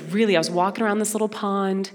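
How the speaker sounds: usual speaking voice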